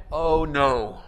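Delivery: low, sinking mock dismay; mock dread. Host hearing guests are dropping by, in low mock dread. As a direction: let the heart sink, voice dropped low. His reaction to surprise visitors.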